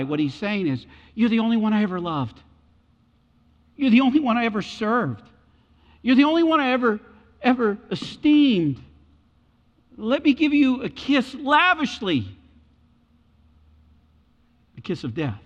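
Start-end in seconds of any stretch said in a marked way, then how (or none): 1.1-12.3 false devotion. Retold or imitated. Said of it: "what Judas is doing here".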